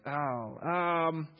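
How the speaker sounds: slow, drawn-out searching for a name, pitch rising; clueless fumbling. Retold or imitated. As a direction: stretch the J names out like a dim guess. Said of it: "He's like".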